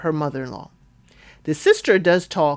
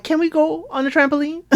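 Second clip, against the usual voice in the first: higher-pitched kid impression; voicing the kids; 9 semitones higher than usual. Recapper mimics kids asking for the trampoline, high kid voice. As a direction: pipe up like a child asking.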